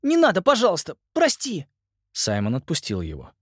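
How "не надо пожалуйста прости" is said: pleading. Acted. Nolan pleading in pain.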